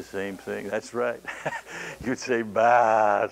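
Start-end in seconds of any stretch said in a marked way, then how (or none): 2.4-3.3 a loud sheep's "Bah"; sheep bleat. Pretend. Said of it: "as loud as you could".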